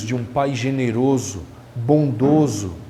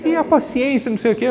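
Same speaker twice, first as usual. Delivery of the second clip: higher, put-on calm voice of a mother giving a talk; calm lecturing mother; 9 semitones higher than usual. A mother talking about patience on an audio, calm. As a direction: act the serene speaker keeping her composure. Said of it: "falando com uma voz tranquila".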